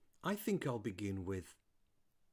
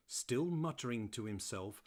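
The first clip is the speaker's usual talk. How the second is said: plain reading voice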